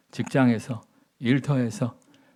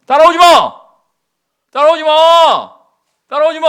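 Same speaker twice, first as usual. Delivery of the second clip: much higher, louder furious yell; angry shout; 13 semitones higher, 17 dB louder than usual. Retold or imitated. Prophet shouting at his follower, furious yell. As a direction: drive him away with a furious shout. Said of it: "막 문전박대를 하지 않습니까?".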